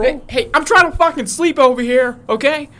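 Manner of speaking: high, loud irritated complaint; annoyed neighbor. Retold or imitated. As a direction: bark at the noisy neighbors. Neighbor complaining about noise.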